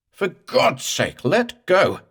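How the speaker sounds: high, loud, slow desperate howl; raw howl. Acted. Morse howls at the ghost to let go. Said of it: "Morse's voice was getting raw".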